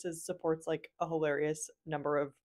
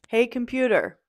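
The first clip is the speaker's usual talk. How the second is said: raised, louder call to wake the assistant; calling the computer; 4 semitones higher, 9 dB louder than usual. Real, not acted. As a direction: call out clearly so the machine hears. Waking the voice assistant.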